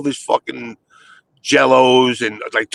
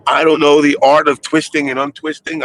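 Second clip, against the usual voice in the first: ordinary talk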